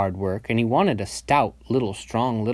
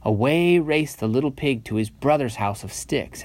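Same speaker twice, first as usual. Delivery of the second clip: usual speaking voice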